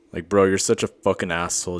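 ordinary talk

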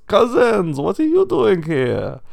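very high, loud, slow pig voice; hammy rich pig. Acted. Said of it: "and goes".

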